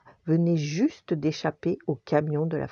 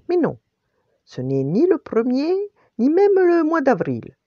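high, loud storybook teasing; playful teasing; 10 semitones higher, 6 dB louder than usual. A narrator's reading. Chef plays dumb with the hungry dog, teasing loudly. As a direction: tease with big swooping pitch.

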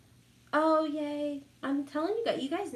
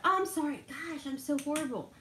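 ordinary delivery

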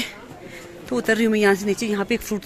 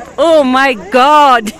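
surprised; 2 semitones higher, 8 dB louder, much slower than usual. Real, not acted. Meets her whole group unexpectedly.

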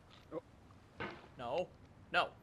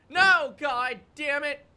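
loud, slow, higher-pitched curse; frustrated cursing; 4 semitones higher, 6 dB louder, slower than usual. Real, not acted. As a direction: yell it in real exasperation. Cursing a fall in game.

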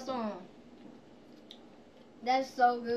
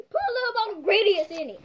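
loud; 9 semitones higher, 11 dB louder than usual. Real, not acted. Shouting the demand.

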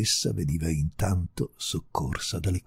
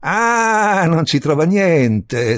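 loud, raised, drawn-out outburst; ranting; 8 semitones higher, 9 dB louder, much slower than usual. A narrator's reading. Visitor throwing the countess's words back, ranting. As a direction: explode in indignant disbelief. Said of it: "strepitò l'altro".

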